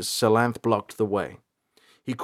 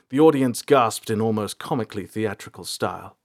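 ordinary delivery

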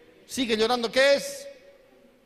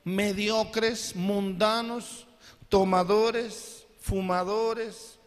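each word drawn out slowly; slow, deliberate preacher's listing of sins; much slower than usual. Real, not acted. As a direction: lay each sin down one by one, slowly.

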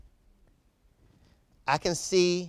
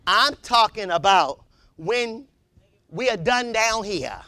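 usual speaking voice

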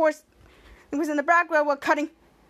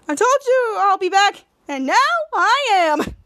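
high, slow, menacing boast; gloating villain voice; 5 semitones higher, 4 dB louder, slower than usual. Acted. Little Dynamite taunting the heroes over the phone, slow gloating. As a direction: lean into the gloat.

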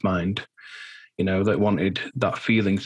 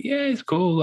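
much higher, breezy mum voice; imitating mum; 11 semitones higher, slower than usual. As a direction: lightly mimic a carefree mum. Mum was like.

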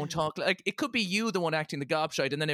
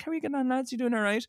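cheery dad; 8 semitones higher, faster than usual. Retold or imitated. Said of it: "be like".

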